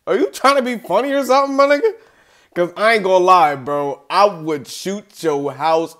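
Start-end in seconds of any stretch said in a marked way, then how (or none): 0.1-1.9 mock outrage. Mock confrontation, pitched up.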